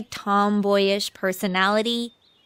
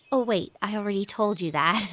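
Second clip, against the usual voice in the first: ordinary talk, nothing marked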